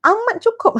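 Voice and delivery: pitched high, emphatic and sure; emphatic contentment. Shown as a demonstration. Ustazah correcting a resigned tone, emphatically content. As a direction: declare total sufficiency with lifted voice. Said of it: "bukan ayat macam tu".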